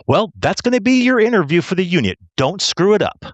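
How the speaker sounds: high-pitched, forceful retold telling-off; imitating a gruffly exasperated instructor; rough warning. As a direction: warn him sternly, pushing each phrase. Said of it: "kind of rough with me".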